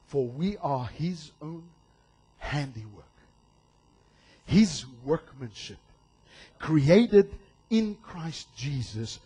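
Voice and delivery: a gentle Bible reading; slow scripture reading. Acted. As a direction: lay each word down slowly. Reads Ephesians verse.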